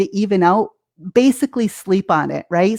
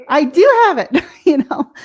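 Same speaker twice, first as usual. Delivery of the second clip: laughing realization; 6 semitones higher, 4 dB louder than usual. Retold or imitated. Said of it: "they're like".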